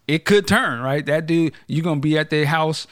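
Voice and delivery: ordinary talk, nothing marked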